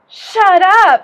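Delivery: put-on loud, high kid's outburst; child shouting a rude word in class; kid blurting. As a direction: blurt it like a cheeky five-year-old. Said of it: "he goes".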